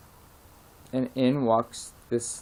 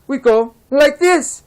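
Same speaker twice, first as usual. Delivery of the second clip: much higher, louder and slow, tiny creature voice; squeaky leprechaun; 8 dB louder, slower than usual. Acted. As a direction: pipe the punchline like a little fairy man. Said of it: "And he says".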